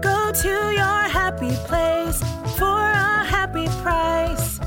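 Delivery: normal talking voice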